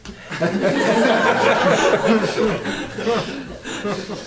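long hearty laugh; burst of laughter. Real, not acted. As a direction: laugh it out at the skit. Laughing at own skit.

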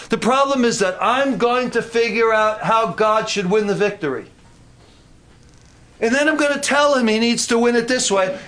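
high-pitched, insistent preacher; impassioned preaching. Real, not acted. Preacher rebuking himself over telling God how to win, impassioned. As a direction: press it home to the congregation.

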